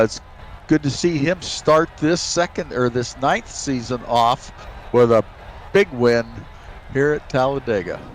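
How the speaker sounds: ordinary talk, nothing marked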